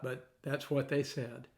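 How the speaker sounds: normal talking voice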